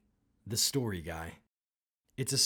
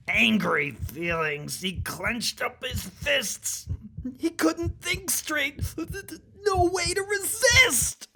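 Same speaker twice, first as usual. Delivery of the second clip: building anger; 13 semitones higher, 5 dB louder than usual. A narrator's reading.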